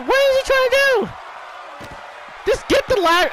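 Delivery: high, pleading yell at the screen; frustrated shout. Real, not acted. Gamer pleading with wrestler on screen, high frustrated shout. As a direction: beg them to just grab the ladder.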